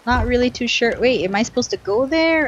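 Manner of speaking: ordinary talk, nothing marked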